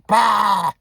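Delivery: loud, high retching "bah"; mock vomiting sound. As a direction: imitate someone throwing up explosively. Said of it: "And vomited, like".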